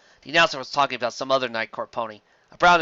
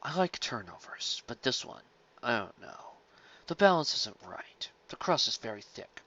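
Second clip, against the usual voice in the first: scholarly critic; 8 dB quieter, slower than usual. A narrator's reading. Night Court pony critiquing the salad, soft and scholarly. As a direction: weigh every word like a serious scholar.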